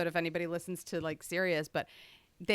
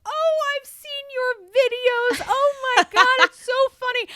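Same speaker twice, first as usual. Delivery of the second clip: put-on high, gushing fan-girl voice, much higher and louder; Kristen David voice; 8 dB louder, slower than usual. Star greets a fan at the event, high and gushing. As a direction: play a celebrity gushing over a fan. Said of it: "And Kristen David goes".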